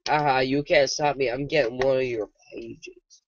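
loud cocky taunt; gloating. Real, not acted. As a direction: gloat at him, loudly. Gloating at Slenderman.

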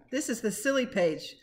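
ordinary delivery, nothing marked

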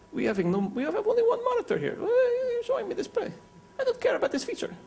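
high, squeaky put-on Eastern European accent; mock Bulgarian complaining in an accent; mock foreign accent. Bulgarian audience member whining in a put-on accent. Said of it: "I can't do an accent here".

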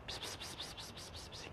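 soft hissed psst calls; psst calls to a cat. Real, not acted. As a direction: coax the cat with whispered psst sounds. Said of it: "psst calls". (leises Locken mit Psst)

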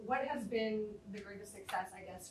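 ordinary talk

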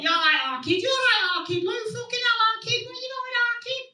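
high, far-off mock Mancunian chant; Manchester accent. Comic mimicking Manchester lads, high Manchester accent. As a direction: ham up a Manc lad calling his mates. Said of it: "Everyone up there calls each other our kid".

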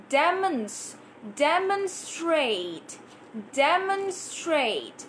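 "demonstrate" drawn out slowly; slow drill. Shown as a demonstration. Teacher repeating "demonstrate" for students, slow. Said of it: "Let's try to repeat these words".

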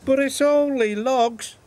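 Henry protesting his logs; chuffing Henry. Acted. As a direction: push back high and eager like a wounded little engine. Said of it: "chuffed Henry".

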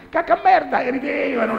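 put-on very high, loud kids' chant; child voice. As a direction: squeal it like giggling kids. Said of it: "i miei figli".

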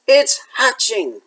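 a hint of excited announcement; urgent note. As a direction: read the note as breathless news. Hagrid's two-word note.

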